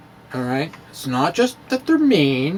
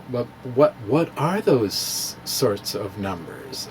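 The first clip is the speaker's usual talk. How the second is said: lower, softer, slow, mock puzzled question; puzzled sceptic; 6 semitones lower, 6 dB quieter, slower than usual. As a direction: play the baffled doubter, quietly and slowly.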